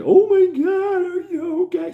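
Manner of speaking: put-on high, loud shriek of a girlfriend freaking out; panicked girlfriend. Imitating his girlfriend freaking out over his nose, high loud panic. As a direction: shriek it like she just saw the blood. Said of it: "She's all freaking out".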